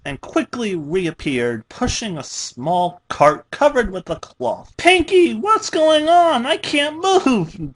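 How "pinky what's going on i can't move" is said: high, loud and slow alarm; urgent. A narrator's reading. Tied-down Dash calls to Pinky, urgent and high. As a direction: plead in a rising panic. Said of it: "Dash said urgently".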